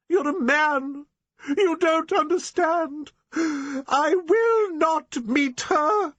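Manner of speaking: a frantic woman dissolving into tears; sobbing hysterical refusal; hysterical crying. Acted. Eleanor refuses, breaking into tears.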